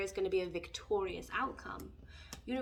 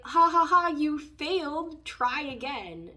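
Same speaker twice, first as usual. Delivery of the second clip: loud, high mocking cackle then slow taunt; mocking universe; 6 semitones higher, 9 dB louder, slower than usual. The universe taunts someone who failed, loud mocking laugh. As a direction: jeer at them like a cruel cosmic bully. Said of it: "and then be like".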